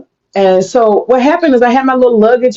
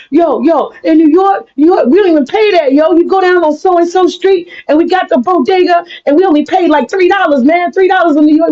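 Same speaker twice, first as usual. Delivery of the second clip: bragging New Yorker; 9 semitones higher than usual. Retold or imitated. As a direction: hype up your neighborhood like a braggart. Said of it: "they love to keep talking about New York".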